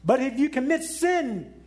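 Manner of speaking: ordinary delivery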